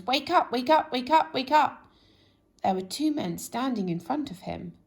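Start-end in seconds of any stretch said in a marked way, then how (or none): 0.0-1.8 much higher, louder shout; loud wake-up call. Acted. Noise shouting to wake Mister Lazy, loud call. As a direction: bellow it to wake a sleeper. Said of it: "said the noise".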